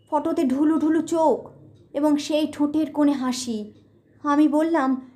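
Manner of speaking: plain reading voice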